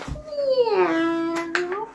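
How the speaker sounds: high cat meow. Acted. Dog meows again.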